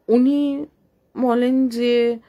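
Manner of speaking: ordinary delivery, nothing marked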